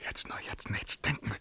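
soft voiceless whisper; quiet urgent whisper; whispered self-warning. Acted. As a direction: hush your own thoughts.

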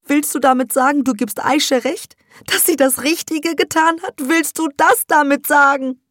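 high, loud, shaken mother. Acted. Mother asks her daughter, high and shaken. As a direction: cry it out in disbelief. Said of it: "fragst du Sevda erschüttert".

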